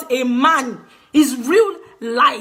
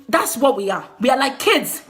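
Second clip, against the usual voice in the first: normal talking voice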